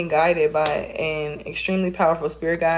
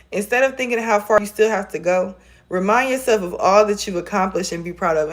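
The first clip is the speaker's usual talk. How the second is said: ordinary delivery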